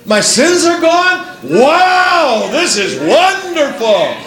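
acted-out burst of joyful disbelief; overjoyed and emotional. Saved sinner hearing the good news, overjoyed and amazed. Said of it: "I gotta get excited about that".

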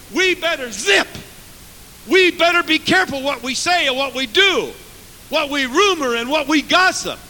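high, loud warning from the pulpit; forceful preaching. Acted. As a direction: drive each phrase home with force.

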